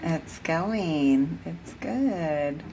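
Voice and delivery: talking through clenched teeth; words forced through gritted teeth. Real, not acted. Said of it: "are you talking through clenched teeth?".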